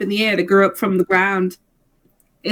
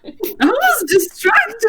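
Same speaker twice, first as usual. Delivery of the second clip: laughing excuse; 11 semitones higher, much slower than usual. Acted. Laughing excuse, high and slow.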